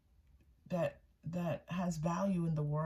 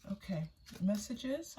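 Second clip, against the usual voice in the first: ordinary talk, nothing marked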